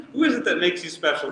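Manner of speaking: quick, high, snarky jab. Shown as a demonstration. Teacher showing the class the snarky reading, fast and high. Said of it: "You can ask that in a snarky tone".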